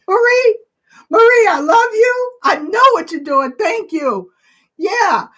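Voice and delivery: high, loud, emotional call-out; grateful outburst. Real, not acted. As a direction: call out love, high between laughter and tears. Calls out gratefully to Marie, laughing.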